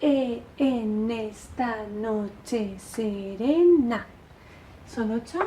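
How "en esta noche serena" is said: very slow, every syllable stretched; recitado en marcha. Shown as a demonstration. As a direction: count out the verse syllable by syllable. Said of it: "como si estuvierais haciendo marcha". (recitando muy lento, marcando cada sílaba)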